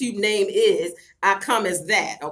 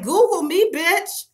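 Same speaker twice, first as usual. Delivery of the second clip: O G quote; 7 semitones higher, slower than usual. Retold or imitated. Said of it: "like O G said".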